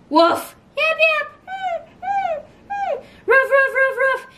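dog barks. Pretend. Counting dogs, barking sounds.

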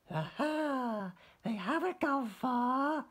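high little-fish voice, brightening; Stanley spots a light, high and hopeful. Acted. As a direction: perk up as tiny Stanley. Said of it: "he said".

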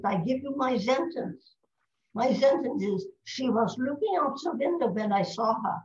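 put-on emphatic German-accented example sentence. Colleague hands her his key sentence, German accent. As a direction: show off the sounds of a German accent. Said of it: "She'll be talking German".

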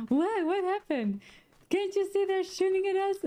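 very high, frantic imitation of a yelled exchange; mock yelling. Driver and friends yelling under fire in game, mock yelling. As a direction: act out panicked shouting in a high pitch. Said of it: "same kind of yelling".

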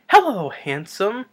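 high, loud, drawn-out cooing hello; flattering greeting. Retold or imitated. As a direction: imitate buttering up a monster. Wilder's character flattering the monster.

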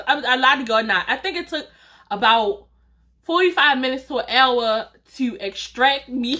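ordinary talk, nothing marked

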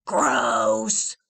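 put-on dopey middle kid; deep gross. Middle boy, low and loud. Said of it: "said the middle-sized boy".

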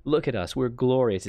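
usual speaking voice

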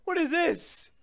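high, loud cry of dismay; alarmed disbelief. Real, not acted. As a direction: react in high-pitched disbelief. Caught off guard in game.

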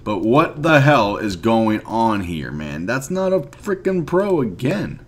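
normal talking voice

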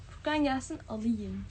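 ordinary delivery

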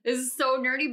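ordinary talk

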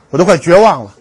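ordinary talk

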